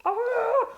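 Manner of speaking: loud, high cry of exasperation; tearful wail. Real, not acted. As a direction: let out a high wail at your own tears. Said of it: "I've got a leaky eye".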